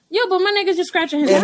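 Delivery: quick high exasperated comeback; exasperated retort. Retold or imitated. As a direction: fire it back fast to her husband. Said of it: "And I'm like".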